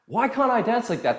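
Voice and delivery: mock a frustrated student's longing; wishful dancer. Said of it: "they watch YouTube and go".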